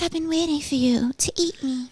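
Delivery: high, softly put-on voice for the food; voiced pastry lady. Dessert talking to the person about to eat it, high put-on lady voice. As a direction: speak as the treat, pitched well above your own. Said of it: "She's like".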